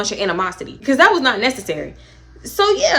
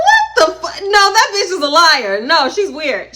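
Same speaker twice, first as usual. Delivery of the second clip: very high, loud disbelief; shocked outrage; 7 semitones higher, 5 dB louder, slower than usual. Retold or imitated. Friend learning she was lied to, loud and very high. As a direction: shriek it in disbelief. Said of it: "I'm like".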